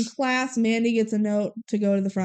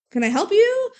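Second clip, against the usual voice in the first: pointed question; 10 semitones higher, 4 dB louder than usual. Retold or imitated. Retold puzzled question to waiters.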